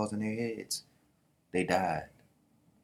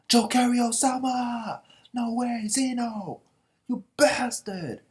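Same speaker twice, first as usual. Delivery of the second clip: very high, drawn-out wailing shout; horrified outcry; 6 dB louder, slower than usual. Acted. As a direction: cry out as if your master was attacked. Men cry out in horror.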